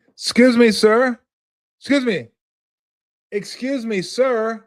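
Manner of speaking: high, loud polite call after someone walking away; imitating a gate agent chasing a passenger; flight attendant calling.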